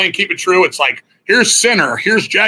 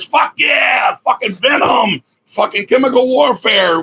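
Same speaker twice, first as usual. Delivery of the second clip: put-on drunken yelling; 8 semitones higher, slower than usual. Band backstage shouting over death metal, rowdy yelling. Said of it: "We're just yelling".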